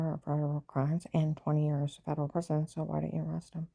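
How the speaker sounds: ordinary delivery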